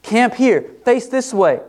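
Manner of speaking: voicing God. Preacher voicing God to Israel, lightly commanding. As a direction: announce the plan step by step, confident. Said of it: "He says".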